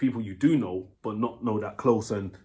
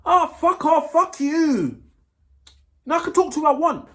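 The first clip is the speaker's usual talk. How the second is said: high, loud mock snap; hammed-up drunk girlfriend snapping back; dismissive girlfriend; 5 dB louder than usual. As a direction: imitate her telling you off, high and loud. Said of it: "and she goes".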